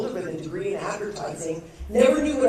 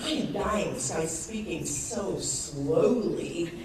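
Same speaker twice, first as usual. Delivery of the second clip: normal talking voice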